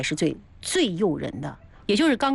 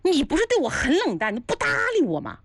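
mildly acted astonishment, pitched up; astonished; 7 semitones higher than usual. Startled man questioning the innkeeper who had ignored him, astonished. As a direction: question it in mock disbelief, voice rising high. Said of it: "他都惊讶".

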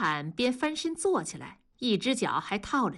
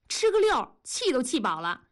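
loud, angry retort; 2 semitones higher than usual. Acted. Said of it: "你那么大声干嘛？". (气冲冲地高声顶嘴)

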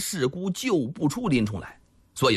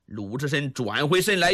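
plain reading voice

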